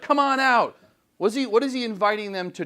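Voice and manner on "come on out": higher, loud taunting call; mock challenge to the gods. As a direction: dare them to come out. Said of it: "He's like".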